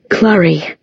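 barely recognizable, rough with feeling; choked-up young man. Acted. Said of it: "His voice was almost unrecognizable".

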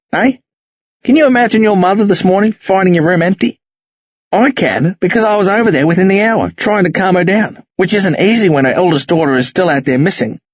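high, heated telling-off; angry scolding. Acted. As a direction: scold them hard. Sarge dresses down kids.

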